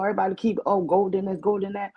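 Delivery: ordinary talk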